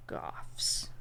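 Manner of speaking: whispered Goths again. Real, not acted. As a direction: breathe the word Goths. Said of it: "whispered answer".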